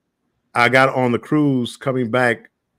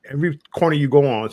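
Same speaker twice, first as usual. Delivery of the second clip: ordinary talk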